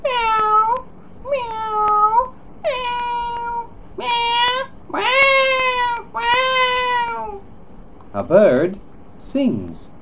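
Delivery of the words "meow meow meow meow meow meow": very high drawn-out meows; cat meows. Shown as a demonstration. Showing children how a cat meows, very high. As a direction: stretch each meow up high like a cat.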